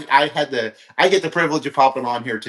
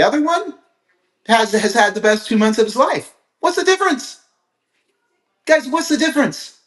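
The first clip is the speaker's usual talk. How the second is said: excited preacher asking the crowd; rising excitement; 10 semitones higher than usual. Real, not acted. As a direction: pitch jumps much higher, driving the question home. Rhetorical punchline to audience.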